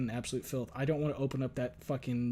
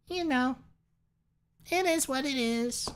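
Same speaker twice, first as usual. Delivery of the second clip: much higher, squeaky and thin; 13 semitones higher than usual. Pretend. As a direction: squeak it out high. Squeaky high voice bit.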